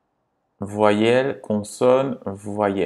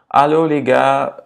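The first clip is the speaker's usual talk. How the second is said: louder, higher call to a group; 4 semitones higher, 6 dB louder than usual. Pretend. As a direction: hail the gang playfully. Interpelle un groupe imaginaire. (interpellation enjouée)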